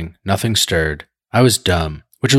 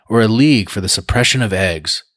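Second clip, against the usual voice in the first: usual speaking voice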